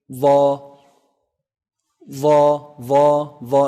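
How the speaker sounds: loud, held "Va", tongue tip on upper incisor edge; heavy letter. Shown as a demonstration. Teacher modelling an Arabic letter, "Va" loud and heavy. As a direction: sound "Va" heavy, tongue just visible between the teeth. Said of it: "It's a heavy letter".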